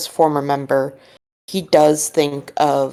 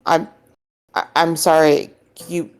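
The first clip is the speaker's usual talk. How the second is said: stammering, then pitched way up; baffled, voice jumping high; genuine confusion; 6 semitones higher than usual. Acted. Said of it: "you can tell genuine confusion".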